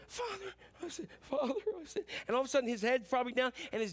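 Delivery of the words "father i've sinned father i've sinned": high, faint voice of a penitent son; rehearsed confession. Acted. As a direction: practise an apology under your breath, pitched up. Son rehearsing his confession to himself.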